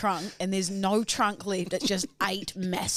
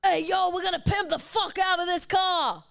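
TV host shout; 9 semitones higher, slower than usual. Retold or imitated. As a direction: yell it like a makeover-show presenter. Quoting makeover TV host.

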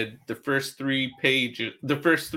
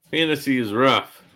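normal talking voice

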